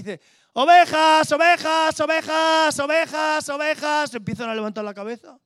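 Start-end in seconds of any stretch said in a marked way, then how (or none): 0.5-4.1 high, loud call that fades as it goes; shepherd calling his sheep, loud, higher and sure; shepherd's call. Retold or imitated. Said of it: "Viene el pastor y hace así".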